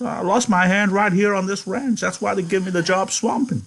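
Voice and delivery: high-pitched, earnest pleading voice; eager old ranch hand. A narrator's reading. Candy pleading to join the plan.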